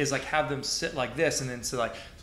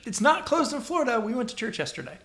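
indignant; 10 semitones higher, 3 dB louder, faster than usual. Real, not acted. Arguing church not closed.

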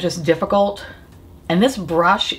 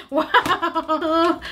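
giggling through pain; laughing why; 8 semitones higher than usual. Real, not acted.

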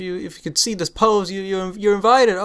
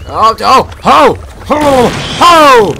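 excited yelps; 7 semitones higher, 11 dB louder, slower than usual. Real, not acted. Sudden scare in the game.

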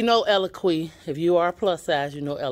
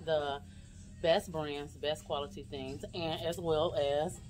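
kind of whispering; 11 dB quieter than usual. Real, not acted. Vlogger to viewers, not disturbing other customers, half-whispering. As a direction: keep it down so others aren't disturbed. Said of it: "I'm kinda whispering".

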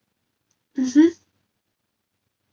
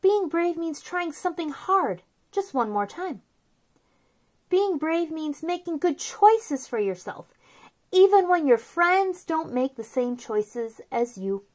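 soft, gentle read-aloud of a picture book; storybook reading; 3 semitones higher, 10 dB quieter than usual. Acted. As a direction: read it softly to little kids, like a bedtime book.

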